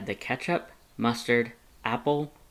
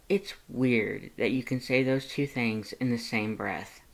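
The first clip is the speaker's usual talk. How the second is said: plain reading voice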